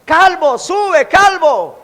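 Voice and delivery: sing-song a schoolyard jeer; mocking taunt. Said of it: "los muchachos se juntaron y le decían". (burla de muchachos, aguda y lenta)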